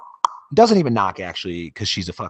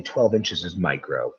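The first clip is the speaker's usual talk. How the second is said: normal talking voice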